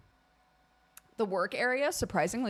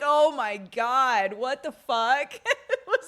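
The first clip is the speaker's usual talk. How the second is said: laughing mock horror; slow, louder, laughing; 3 semitones higher, 7 dB louder, slower than usual. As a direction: cackle through disbelief.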